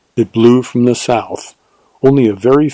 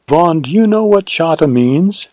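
higher-pitched, mildly grave question; 6 semitones higher than usual. A narrator's reading. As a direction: ask with a touch of gravity. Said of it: "she asked gravely".

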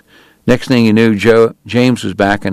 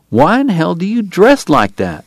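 high, slow, honestly baffled question; genuinely puzzled; 9 semitones higher than usual. Acted. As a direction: ask it truly puzzled, drawn out. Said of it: "genuinely puzzled".

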